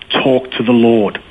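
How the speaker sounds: slow, deliberate advice at ordinary pitch; retold measured insistence. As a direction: repeat it slowly, letting each word land. Friend repeats exhortation more deliberately.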